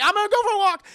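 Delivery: high, loud insistence; insistent shout. Retold or imitated. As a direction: blurt it out stubbornly. Danny insisting on leaving.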